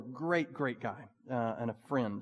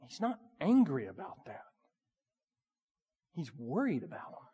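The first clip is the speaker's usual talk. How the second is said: quiet worried concern; 4 dB quieter than usual. Retold or imitated. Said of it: "they also would have got".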